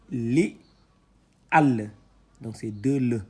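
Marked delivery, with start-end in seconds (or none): 0.1-1.9 loud, drawn-out syllables read one by one; syllable reading drill. Shown as a demonstration. Teacher spelling out syllables loudly for students. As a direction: sound out each letter for the class.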